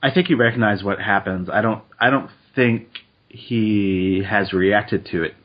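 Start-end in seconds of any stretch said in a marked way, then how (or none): none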